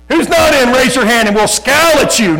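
very high, loud, joking challenge; hammed-up shouted roll call; mock threatening shout. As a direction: bellow a playful threat, drawing it out. Joking threat, then "I'm just.

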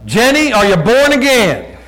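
high, loud and drawn out; loud blunt question. Retold or imitated. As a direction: call it out slowly, high and loud. Retold blunt question to waitress.

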